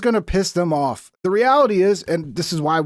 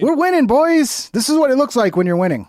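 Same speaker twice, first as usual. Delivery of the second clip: sarcastic cheer; 7 semitones higher, 3 dB louder than usual. Acted. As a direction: cheer it like a fake victory lap. Sarcastic cheer amid missile strikes.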